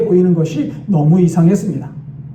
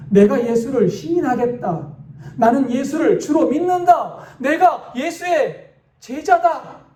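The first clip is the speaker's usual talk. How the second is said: trembling declaration; 8 semitones higher than usual. A narrator's reading. Soldier before the stunned crowd, making a fervent trembling declaration. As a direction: declare it fervently, slowing at the very end. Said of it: "떨리는 목소리로 계속 말을 이어갑니다".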